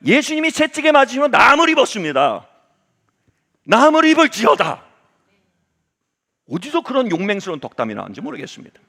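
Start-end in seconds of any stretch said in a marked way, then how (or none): 0.1-4.9 높고 크게 외치는 치유 선포; 안수하며 기도하듯 힘껏 선포하기. Retold or imitated. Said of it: "덕담을 세게 했어요". (우렁차고 높은 목소리로 선포)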